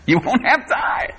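high, laughing shout to the congregation; gleeful preacher's outburst; exuberant shout. Real, not acted. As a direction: call it out high through a laugh.

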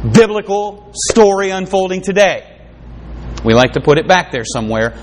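usual speaking voice

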